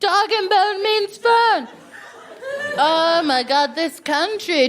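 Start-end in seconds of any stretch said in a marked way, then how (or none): 0.0-1.7 higher, louder, slow, laughing; delighted repeat. Shown as a demonstration. Comic echoing the audience member's slang, delighted. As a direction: repeat it in gleeful disbelief. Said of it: "repeats slang".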